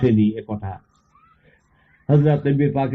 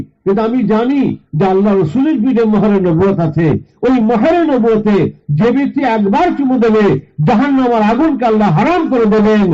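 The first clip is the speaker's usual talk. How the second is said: high, impassioned pleading to the Prophet; preacher's fervent imitation of a weeping confession; acted tearful plea; 9 semitones higher, 4 dB louder than usual. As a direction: plead at a raised pitch, as if through tears. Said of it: "চুমু খেয়ে কাঁদতে কাঁদতে এসে বলছে".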